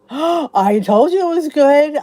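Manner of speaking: gasp, then loud and high; thrilled I-told-you-so; excited gasp. Real, not acted. Delighted viewer loved show.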